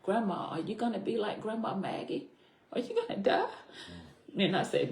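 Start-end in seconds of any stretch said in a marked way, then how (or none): 0.0-3.5 higher-pitched imitation of a crying granddaughter; retold tearful child. Granddaughter asking grandma about dying, retold as tearful. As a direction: softly mimic a frightened child's question, pitch climbing at the end. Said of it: "she started crying, and she said".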